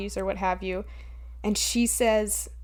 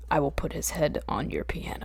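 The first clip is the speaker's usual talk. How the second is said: breathy hushed menace, all air; whisper; 7 semitones lower, 7 dB quieter than usual. Retold or imitated. Imitating the hitman in a hushed whisper. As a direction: whisper the threat low and soft. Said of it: "he switches to, like".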